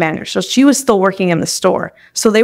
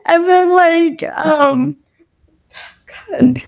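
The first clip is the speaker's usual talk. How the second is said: crying hard, voice high and breaking; real crying; 6 semitones higher, slower than usual. Real, not acted. Reliving the firing for the host, openly crying. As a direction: let real sobs break through the words. Retelling the day she bawled.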